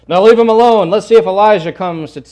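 acted shout of command; urgent bystander. Bystander's order acted out from scripture.

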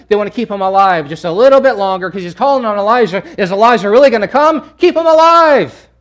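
excited preacher mimicking onlookers, climaxing in a cry; frantic eagerness. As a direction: work up to a crowd's shout. Acts out crowd's eagerness, building.

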